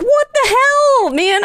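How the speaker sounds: hammed-up outrage; mock outrage. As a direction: stretch it out in disbelief. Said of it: "I was like".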